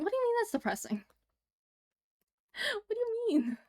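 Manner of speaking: high, fast indignant squeak; high-pitched playful outrage; mock indignant. Real, not acted. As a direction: protest the jab, half laughing.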